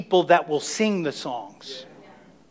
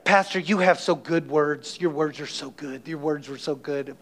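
a hint of the young man's halting English, softer; imitating the young man's phrasing; 4 semitones lower, 6 dB quieter than usual. Pastor retelling a young man's compliment, softly imitating him. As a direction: lightly mimic his broken grammar, quieter. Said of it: "I thought that was really funny how he said that".

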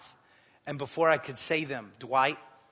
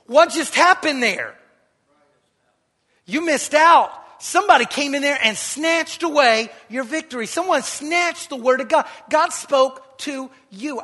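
higher, louder preaching, building; rising preacher's cry; 11 semitones higher, 12 dB louder than usual. Acted. Preacher confronting the congregation about missed miracles, loud. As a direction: lift it up and press the point.

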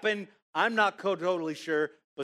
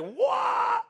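one long, high mock scream; imitated scream. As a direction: let out a witch's startled shriek. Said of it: "She yelled. She was like".

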